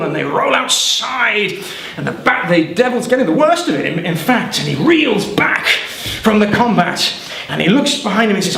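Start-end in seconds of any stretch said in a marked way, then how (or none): none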